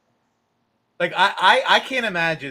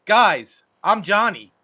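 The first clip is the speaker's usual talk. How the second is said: small player protesting; 4 semitones higher, 8 dB louder, slower than usual. Retold or imitated. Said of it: "and Johnny's like".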